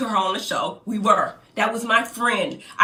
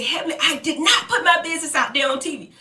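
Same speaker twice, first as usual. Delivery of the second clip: imitating a crying friend; 6 semitones higher than usual. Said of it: "She started hollering and crying on the phone".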